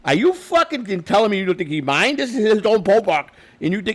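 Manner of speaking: high, loud incredulous yell; retold angry shout. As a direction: hammer the visiting manager with outrage.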